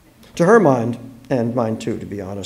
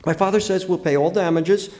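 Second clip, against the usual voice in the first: higher, lightly voiced girl; earnest young girl; 7 semitones higher than usual. A narrator's reading. Girl passing on her father's offer, earnest. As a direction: offer amends in a girl's voice.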